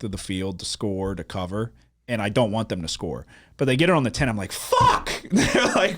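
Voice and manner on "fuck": very high-pitched retold yelp of frustration; explosive mock swear; frustrated outburst. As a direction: re-enact cursing at the TV. Retells own outburst watching game.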